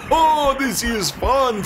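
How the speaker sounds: high, slow and delighted; gleeful Anansi. Acted. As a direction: squeal it with joy. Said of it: "said Anansi".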